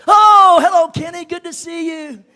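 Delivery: high, loud, bright welcome of a hostess; excited hostess opening the door; cheerful greeting. Retold or imitated. Sister Branham greets at door.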